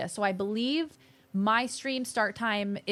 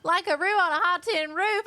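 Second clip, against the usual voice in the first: incredulous; 7 semitones higher, 5 dB louder than usual. Real, not acted. Incredulous echo of odd phrase.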